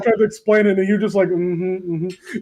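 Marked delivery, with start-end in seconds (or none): 1.3-2.4 dismissive mm-hmm. Retold or imitated. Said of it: "that kind of, like, "I'm listening".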